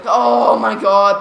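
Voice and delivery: ordinary delivery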